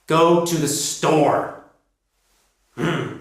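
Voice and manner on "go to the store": slow, firm GO TO THE STORE falling on STORE; emphatic falling tune. Shown as a demonstration. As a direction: drop the pitch on "store" for emphasis. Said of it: "emphasize something or stress it, we go down".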